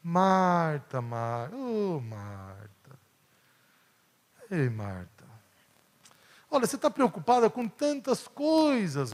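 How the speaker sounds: put-on tender dad gently teaching his little girl; patient fatherly tone. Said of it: "essa entonação na voz de Jesus".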